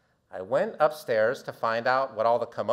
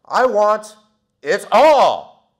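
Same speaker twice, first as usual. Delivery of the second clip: much higher, much louder I want it all; loud declaration; 7 semitones higher, 14 dB louder, much slower than usual. Retold or imitated. Teen finishing his order at the counter, shouting it out. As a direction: belt out the final demand. End of a long sandwich order.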